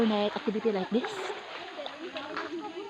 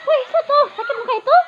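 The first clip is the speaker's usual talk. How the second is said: surprised; 14 semitones higher, 8 dB louder, much faster than usual. Real, not acted. Alarmed at someone's fall.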